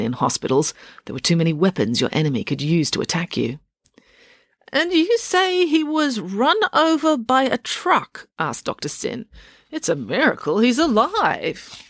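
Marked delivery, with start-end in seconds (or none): 4.7-8.3 put-on high, slow, quavering old doctor voice; a very old, deaf doctor questioning Dad; elderly doctor. Scene: old doctor questioning Dad at the bedside, high and slow. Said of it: "incredibly elderly and deeply unimpressive doctor". 9.7-11.8 put-on elderly doctor. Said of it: "incredibly elderly and deeply unimpressive doctor".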